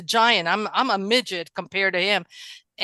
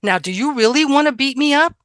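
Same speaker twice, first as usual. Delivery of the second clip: retold challenge; 6 semitones higher, 6 dB louder than usual. Retold threat to bully.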